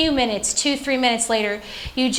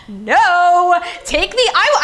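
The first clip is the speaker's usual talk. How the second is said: loud, high drawn-out "no"; defiant refusal; 6 semitones higher, 6 dB louder, much slower than usual. Retold or imitated. New mother refusing nurses who told her to sit, loud defiance. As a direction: cry out in protest, louder and higher.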